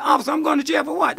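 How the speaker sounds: arrested man protesting, high and fast; disbelief. Retold or imitated. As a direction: toss it back in disbelief.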